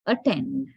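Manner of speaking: attend beginning with a short 'uh'; schwa pronunciation. Shown as a demonstration. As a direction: say attend as 'uh-TEND'. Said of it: "this is".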